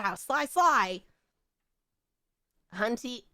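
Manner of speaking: ordinary talk, nothing marked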